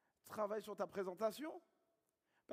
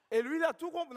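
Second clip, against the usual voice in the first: much higher and louder disbelief; incredulous; 10 semitones higher, 10 dB louder than usual. Real, not acted. Coach telling the room he can't believe the prospect, loud disbelief. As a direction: exclaim it in disbelief, high and loud.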